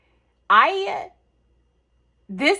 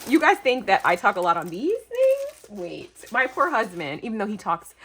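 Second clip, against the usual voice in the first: normal talking voice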